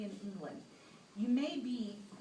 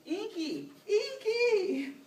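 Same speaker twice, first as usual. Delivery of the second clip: high, loud calls, louder on the second; calling out; 11 semitones higher, 10 dB louder, much slower than usual. Acted. Man calling the ghost for help, the second call louder. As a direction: shout for Inky across the room.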